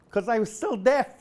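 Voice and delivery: normal talking voice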